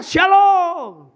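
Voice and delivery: high, loud beaming "Shalom"; cheery greeting. Retold or imitated. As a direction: greet the other driver brightly and loud. Greeting the driver kindly instead.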